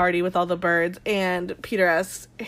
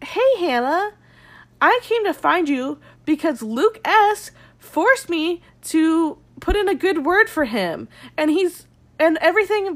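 mocking the psycho; 5 semitones higher than usual. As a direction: play him as a smug weirdo. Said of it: "Luke fucking the psycho weirdo goes and finds Hannah and is like".

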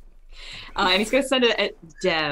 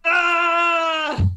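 long, loud, high "aah"; anguished wail; 9 semitones higher, 5 dB louder than usual. Real, not acted. Player told the spell missed, long wail. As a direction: wail at the wasted roll.

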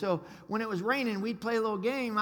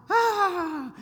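much higher hammed-up cry of pain; mock yelp; 9 semitones higher, 5 dB louder than usual. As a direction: let out a cartoonish yelp. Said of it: "he'd go".

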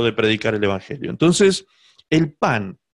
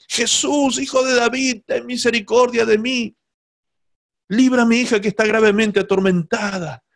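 imitated crying out; 7 semitones higher than usual. La mujer clama tras Jesús por su hija, súplica aguda a medio grito. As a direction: call after him in a raised, begging voice. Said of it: "venía a los gritos".

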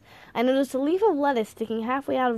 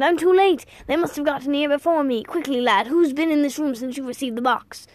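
put-on agitated old man, raised voice; alarmed exclamation; 4 semitones higher, 5 dB louder than usual. A narrator's reading. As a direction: blurt it out in urgent dismay. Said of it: "he exclaimed".

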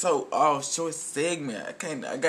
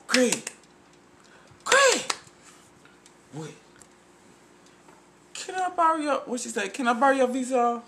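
much higher mock woman voice; woman's voice quote; 9 semitones higher than usual. Friend quoting the neighbor calling Craig, high-pitched. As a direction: call out high like a woman asking a favor. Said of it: "She said".

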